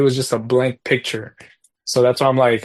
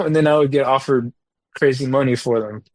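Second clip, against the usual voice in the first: usual speaking voice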